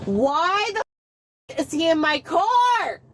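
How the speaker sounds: real high-pitched outraged demand; angry yell. Real, not acted. Repeats protest, yelling.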